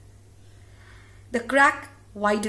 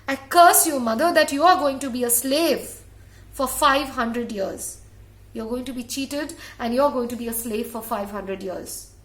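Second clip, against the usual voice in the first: loud furious curse; 5 dB louder than usual. Acted. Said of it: "he was so angry".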